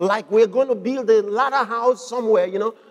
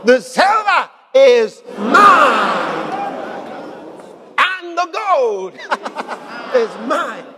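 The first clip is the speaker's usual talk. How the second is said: slow, loud shout, words stretched out; booming proclamation; 3 semitones higher, 4 dB louder, much slower than usual. Real, not acted. Preacher voicing the Lord's claim on silver, booming proclamation. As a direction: proclaim it loud, drawing out each word.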